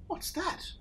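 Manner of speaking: small, wide-eyed question; curious animals. Acted. The animals ask Dingo, high and small. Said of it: "asked the animals".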